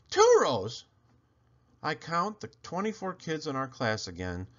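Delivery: plain reading voice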